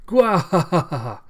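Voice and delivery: loud, high hammed-up evil laugh; villain cackle. Cyborg head gloating after spearing Ito, evil cackle. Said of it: "He goes".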